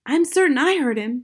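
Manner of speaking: high, loud insistence; insistent cry. A narrator's reading. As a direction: cry out that you're certain. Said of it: "Matilda cried".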